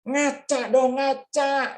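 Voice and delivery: high, loud, teasing "look in a mirror"; sing-song schoolyard mockery; mocking jeer. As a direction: taunt someone about their looks. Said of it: "dibilang begini".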